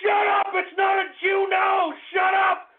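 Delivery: high-pitched screaming tirade; furious shouting. Real, not acted. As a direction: scream back at the hecklers in the chat room. Yelling back at chat taunts.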